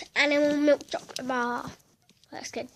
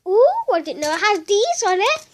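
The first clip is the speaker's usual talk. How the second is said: excited; 6 semitones higher, 8 dB louder than usual. Real, not acted. Delighted at chocolate design.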